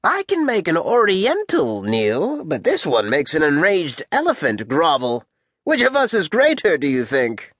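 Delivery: high-pitched, swooping conqueror; Alexander. Acted. Rider boasts to his officer, high-pitched. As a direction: boast in a high, grating lord's voice. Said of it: "He has a high-pitched voice".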